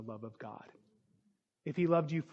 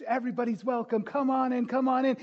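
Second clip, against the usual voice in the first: mock welcome; 8 semitones higher, 4 dB louder than usual. Mock greeter at church door.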